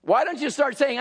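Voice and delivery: ordinary talk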